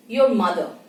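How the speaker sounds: ordinary talk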